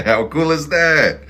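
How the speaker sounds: high, loud and slow; delighted. Real, not acted. Reader marvelling at Mars and Pluto, high and thrilled. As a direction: savour it in amazement.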